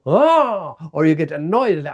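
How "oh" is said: high, loud fired-up oh; energized oh. Shown as a demonstration. Failing but gaining energy, a high loud charged oh. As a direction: react with a jolt of energy. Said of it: "you go".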